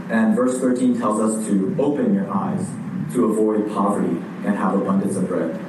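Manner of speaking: ordinary talk, nothing marked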